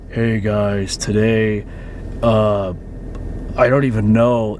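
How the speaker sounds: mock bored vlogger, dropped low and mumbled; lazy low-effort youtuber. Creator mocking lazy vloggers, dropped low and mumbling. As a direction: lightly imitate a lazy creator with nothing to say. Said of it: "The personalities are like".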